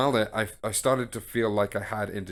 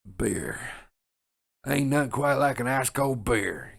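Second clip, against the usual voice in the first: country drawl, raised and louder; good ol' Southern boy accent; 3 semitones higher, 3 dB louder, slower than usual. Retold or imitated. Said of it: "the South of America. Like, country".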